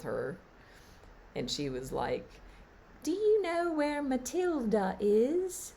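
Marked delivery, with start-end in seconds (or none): 3.0-5.7 put-on high, little girl's voice, far above her own; a curious small child asking around; little British girl. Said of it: "She was this little British girl".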